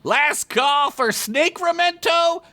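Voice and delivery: high drawn-out little conductor call; conductor shout. Acted. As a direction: announce the stops like a tiny reptoid. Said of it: "the little conductor shouted".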